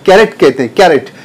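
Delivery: कैरेट with a short e in the middle, ke-ret; correct pronunciation. Shown as a demonstration. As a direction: say कैरेट, not कैरोट. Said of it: "सी का sound हमको के से लेना है". (कैरेट, बीच में छोटा ए)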